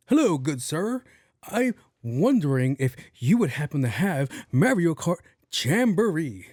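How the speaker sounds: put-on high, theatrical old-time politeness; in-character gamer. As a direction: imitate an over-formal gamer playing a grand character. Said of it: "Why the fuck is he talking like that?".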